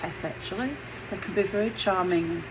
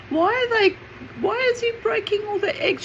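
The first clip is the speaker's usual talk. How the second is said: put-on child's question; faster than usual. Child self asks mum.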